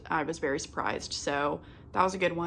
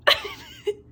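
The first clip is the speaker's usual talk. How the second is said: sudden squeaky laugh that breaks into a sob; a real cry that comes out as a laugh; 6 dB louder than usual. Real, not acted. Laughing at her own tears, real laugh-cry. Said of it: "I don't know where that came from".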